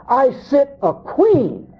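lightly acted high, slow boast; self-important queen. Quoting the self-crowned bride.

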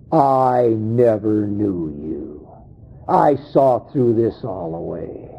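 low, grave pronouncement; solemn judgment. Acted. Acting the Lord's judgment on them.

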